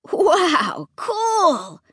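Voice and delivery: high, drawn-out thrilled exclamation; excited cry. A narrator's reading. Boy sees the mechanical monster, excited cry. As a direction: awestruck boy crying out. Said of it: "he cried".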